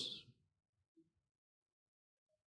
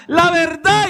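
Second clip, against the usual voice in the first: high, louder insistent urge; Holy Spirit prompting; 8 semitones higher, 3 dB louder, slower than usual. Acted. Holy Spirit urging the believer, high and insistent. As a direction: press the word like an inner voice.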